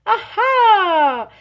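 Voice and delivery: high, loud, delighted Aha!; triumphant aha. Acted. Big Anthony pleased with himself, loud excited aha. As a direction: crow it out like he's cracked the secret. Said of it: "said Big Anthony".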